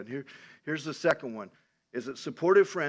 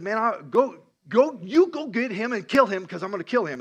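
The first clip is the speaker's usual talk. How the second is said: loud, higher angry order; retold rage; 6 semitones higher, 7 dB louder than usual. Saul ordering Jonathan to go get David, acted-out rage. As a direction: imitate a furious king giving orders. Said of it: "he gets so mad at Jonathan".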